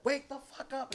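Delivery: high-pitched, held-back mock yell; mock angry mom. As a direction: imitate a mom rousing her son. Mom slapping son awake.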